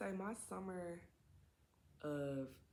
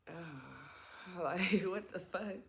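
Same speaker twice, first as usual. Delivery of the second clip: disgusted laugh; 2 semitones higher, 4 dB louder, much slower than usual. Retold or imitated. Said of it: "I'm just like".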